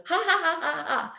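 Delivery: high-pitched mock belly laugh; imitated laughter. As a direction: imitate his gleeful ha-ha-ha, pitched well up. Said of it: "he starts laughing. He goes".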